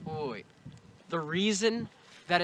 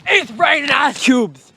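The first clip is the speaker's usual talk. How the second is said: louder, slow proclamation; mock loud announcement; 5 semitones higher, 12 dB louder than usual. Vlogger shouting the ice news to the camera, loud and slow. Repeats line in own voice, loud.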